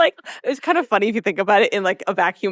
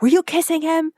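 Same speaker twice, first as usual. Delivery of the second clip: high, accusing daughter's voice; upset Rory; 7 semitones higher than usual. Retold or imitated. Rory confronting Lorelai about the kiss, high and accusing. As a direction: demand an answer from mom.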